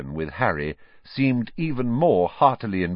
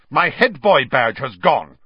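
loud, higher angry complaint; indignant outburst; 5 semitones higher, 9 dB louder than usual. A narrator's reading. Percy rounding on Harry over lost badge, indignant. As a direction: round on the newcomer.

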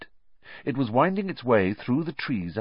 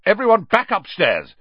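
raised, loud command; bossy order; 9 semitones higher, 8 dB louder than usual. Acted. Head boy hurries in to clear the common room, bossy shout. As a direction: call out an order to the crowd. Percy orders everyone to bed.

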